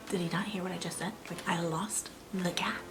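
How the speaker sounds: soft whispered aside, slowing on the last part; incredulous whisper. Retold or imitated. Whispering to herself, stunned that her husband didn't react. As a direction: whisper it to yourself in disbelief.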